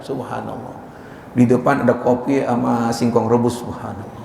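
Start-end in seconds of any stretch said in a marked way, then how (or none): 0.0-0.7 hushed awe. Acted. Awe at village morning scene. 3.6-4.2 hushed awe. Acted. Awe at village morning scene.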